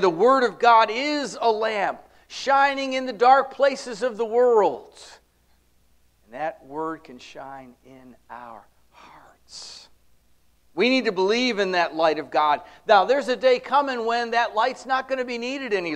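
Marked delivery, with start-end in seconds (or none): 6.2-9.9 slow, hushed, lower whisper; a tender slow pulpit whisper. Real, not acted. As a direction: breathe it like a secret, slowly. Preacher drops to whisper.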